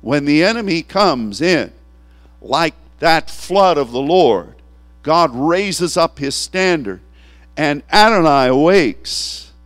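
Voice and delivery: rising, slow, forceful preaching; preaching at full force. Acted. Preacher proclaiming to the congregation, forceful. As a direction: proclaim it over the room.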